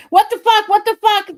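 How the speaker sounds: high, loud frustrated scream. Real, not acted. Streamer screaming at a broken mute button. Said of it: "Now I'm screaming".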